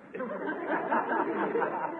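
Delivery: high embarrassed laugh; flustered chuckle. Acted. Customer caught out by the druggist, high embarrassed laugh.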